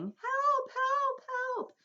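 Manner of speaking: very high, thin cries of help; calling for help. Retold or imitated. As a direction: call helplessly from the ceiling. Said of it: "we could hear her calling to us".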